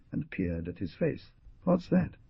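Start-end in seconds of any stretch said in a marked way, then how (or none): 1.6-2.2 high, sharp demand; a sharp, suspicious question. Acted. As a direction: snap the question at him. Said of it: "he asked sharply".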